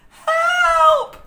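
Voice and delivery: high, loud terrified scream; scream for help. A narrator's reading. Annie sees a crocodile, screams for help. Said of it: "screamed Annie".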